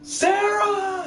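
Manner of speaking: high, drawn-out mock cry; mock calling out. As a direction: call the name out long and high, hammed-up. Echoes game character calling Sarah.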